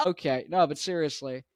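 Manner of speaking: ordinary delivery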